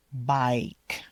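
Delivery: ordinary talk, nothing marked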